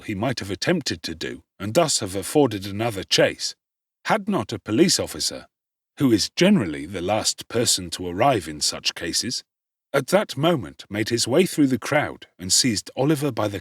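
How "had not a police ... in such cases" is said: ordinary delivery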